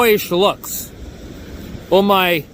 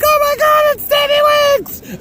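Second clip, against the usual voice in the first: put-on shrieking fan girl. Imagined fans outside the bagel store, very high squealing. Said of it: "they'd be like".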